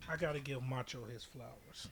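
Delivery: normal talking voice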